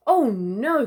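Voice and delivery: loud dismayed groan. Acted. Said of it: "Crikey groaned".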